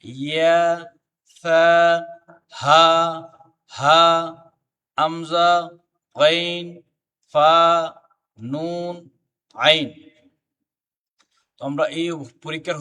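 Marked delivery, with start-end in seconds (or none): 0.0-9.9 each letter drawn out on a level note; letter-by-letter recitation. Shown as a demonstration. Teacher reciting Arabic letters for the class to follow, drawn-out recitation. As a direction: chant the letters singly, with long vowels and gaps.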